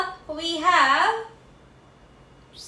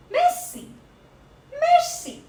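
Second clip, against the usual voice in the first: high-pitched repetition; 13 semitones higher than usual. Shown as a demonstration. Class echoes the word.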